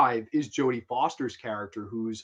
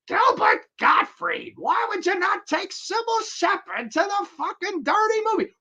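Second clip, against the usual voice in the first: Gilbert Gottfried rant; 5 dB louder than usual. Pretend. Fan asking a comedian for a rant, very high screechy voice. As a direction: go full squawking comedian rant. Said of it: "Like, full on".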